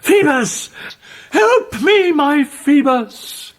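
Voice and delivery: very high, loud cry for help; desperate shouting. A narrator's reading. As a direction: scream his name from the window.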